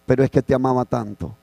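a mild teary whisper of love; llorando. Retold or imitated. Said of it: "Y llorando ella le decía". (confesión suave y llorosa)

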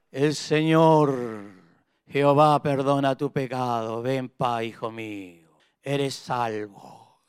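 low, slow, soft blessing voice; pious priest blessing. Retold or imitated. Preacher mimicking the temple priest, low slow blessing. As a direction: intone it like a priest absolving sin. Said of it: "el que oraba y decía".

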